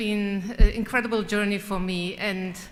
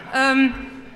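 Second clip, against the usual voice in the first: laughing; 3 semitones higher than usual. Real, not acted. Laughs about winning her election.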